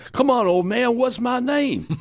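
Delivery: high, loud goading demand; Muhammad Ali. Retold or imitated. Champ prodding an old man for a reaction, high goading. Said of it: "So he says again".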